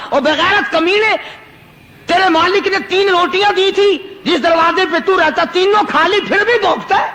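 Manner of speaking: a holy man rebuking a barking dog, angrily; angry scolding. Acted. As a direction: berate the dog from the pulpit. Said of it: "غصے میں آ گیا اور کہنے لگا".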